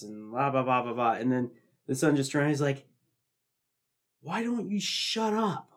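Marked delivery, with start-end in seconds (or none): 4.2-5.7 retold yell at the lady. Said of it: "he just yells at her".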